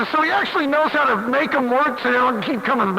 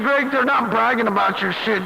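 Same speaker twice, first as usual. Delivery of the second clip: usual speaking voice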